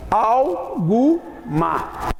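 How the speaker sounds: alguma very slowly, "al... gu... ma"; distinct pronunciation. Shown as a demonstration. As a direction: sound the u fully in alguma, syllable by syllable. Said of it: "é pronunciado distintamente".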